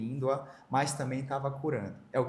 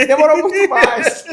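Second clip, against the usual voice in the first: higher and much louder, breaking into laughter; 20 dB louder than usual. Real, not acted. Guest cracks up admitting it took longer, loud laugh.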